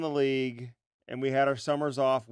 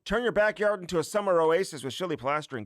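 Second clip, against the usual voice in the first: high, loud radio ad pitch; loud ad read; 7 semitones higher, 5 dB louder than usual. Acted. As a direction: sell it like a hyped commercial.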